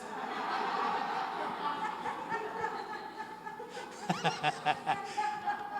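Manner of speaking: real amused laughter; high breathy laugh. Real, not acted. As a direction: let a long high laugh out. Laughing at his own story.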